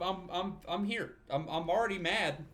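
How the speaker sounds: ordinary talk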